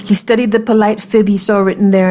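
ordinary delivery, nothing marked

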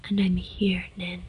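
whispered. Acted. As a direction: whisper it close and tender. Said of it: "he whispered".

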